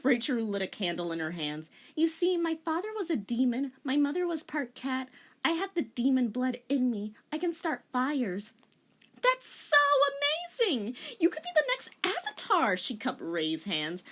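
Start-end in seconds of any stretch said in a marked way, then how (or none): 2.0-8.5 put-on high, proud edgy girl; lightly voiced dramatic goth girl. Rei boasting demon heritage. 9.2-12.8 very high, excited squeal; shrieking with delight; gushing. Acted. Korra gushing over Rei.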